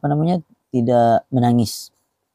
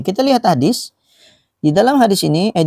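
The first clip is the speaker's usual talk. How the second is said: ordinary delivery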